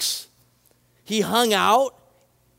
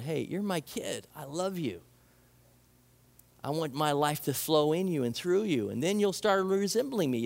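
much softer, gentle and loving; tender voice of God; 5 semitones lower, 5 dB quieter than usual. Retold or imitated. God speaking to his kid, softer and tender. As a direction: speak it like a father reassuring his child. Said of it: "It's like God's going".

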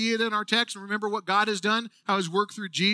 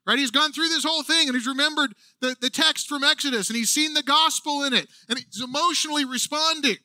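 usual speaking voice

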